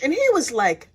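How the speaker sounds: ordinary delivery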